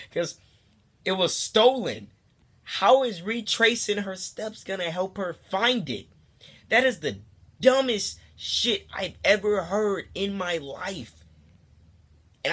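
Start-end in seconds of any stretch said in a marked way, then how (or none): 0.1-11.2 high, slow, emphatic indignation; hammed-up exasperated rant; exasperated outrage. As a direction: drag each word out in loud disbelief. Outraged at the stupid advice.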